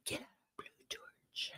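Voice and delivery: very soft voiceless whisper; barely audible, all breath. Real, not acted. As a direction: whisper it under your breath. Phrase repeated in a whisper.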